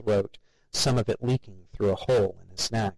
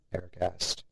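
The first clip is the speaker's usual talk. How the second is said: plain reading voice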